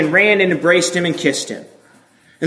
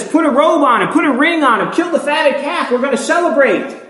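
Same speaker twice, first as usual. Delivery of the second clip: eager joyful father; 8 semitones higher than usual. Acted. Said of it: "He immediately says".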